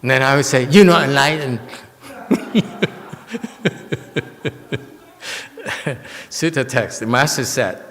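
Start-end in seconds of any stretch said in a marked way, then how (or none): 0.7-1.6 louder, higher playful comeback; teasing jab thrown back; mock retort. Imagined retort to the master. 2.1-4.8 breathy giggle, high and falling; high airy laugh. Real, not acted. Laughs at own joke.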